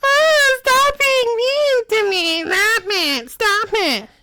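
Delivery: high, slow whiny imitation; mock whining. Imitating the character who whines when laughed at. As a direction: whine stop it like a crybaby. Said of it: "but the minute it happens to you".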